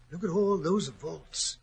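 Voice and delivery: ordinary delivery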